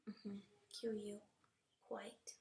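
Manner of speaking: Q U breathed softly; whispered letters. Shown as a demonstration. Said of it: "It says".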